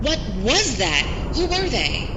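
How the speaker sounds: high, rattled cries; frightened questions. Acted. Reporter shaken after the ambush, asking the crew in fright. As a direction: voice jumping up in alarm.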